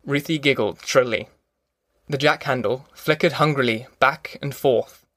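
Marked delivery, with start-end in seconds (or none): none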